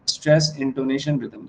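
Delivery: normal talking voice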